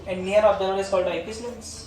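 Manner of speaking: slow, word-by-word dictation; patient classroom dictation. Acted. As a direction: read the definition out for copying. Continues dictation.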